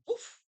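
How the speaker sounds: high loud woof like a dog. A narrator's reading. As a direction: bark it out like a happy dog. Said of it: "and said".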